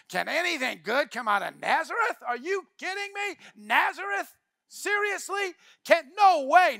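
high, loud, incredulous mockery; sarcastic disbelief. Nathanael mocking Philip's claim about Nazareth, sarcastic. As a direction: scoff as if it's a joke. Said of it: "a symphony of sarcasm".

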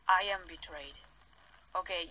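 normal talking voice

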